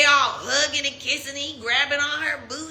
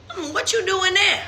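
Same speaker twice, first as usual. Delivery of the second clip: pitched-up, suspicious musing; raised, knowing hum of suspicion; suspicious inner question; 8 semitones higher than usual. Retold or imitated. Voicing her own suspicious thought.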